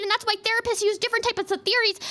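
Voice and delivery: ordinary delivery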